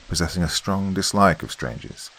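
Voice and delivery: usual speaking voice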